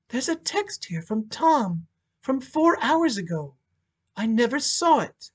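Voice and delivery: stunned by a message she missed; stunned widow. Acted. Widow to lawyer and detectives, stunned. Monica reads husband's text.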